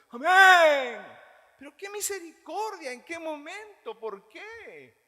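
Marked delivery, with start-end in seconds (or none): 0.1-1.1 much higher, much louder exaggerated amen; mock congregation amen. Congregation answering the fake preacher, loud high mock amen. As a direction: shout the amen like a swept-up listener. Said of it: "Y usted".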